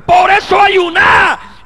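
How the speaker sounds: high, slow, mocking yell of "ayuná"; mock excuse shout. Preacher mocking the believer's excuse, high slow shout. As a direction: stretch "por eso ayuná" into a wailing shout. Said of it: "Hermano, es que yo padezco".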